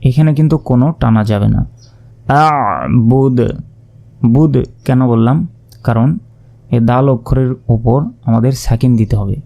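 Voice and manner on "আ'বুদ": আ'বুদ cut short on the dal, no drawing; আ'বুদ ending short with sukun; stopping with sukun. Shown as a demonstration. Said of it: "শেষের অক্ষরে সাকিন দিতে হবে".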